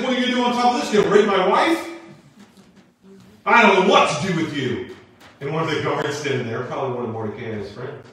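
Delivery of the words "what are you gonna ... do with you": high, loud mock fury; outraged king. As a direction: act out a husband's shouted outrage. King finds Haman on his wife.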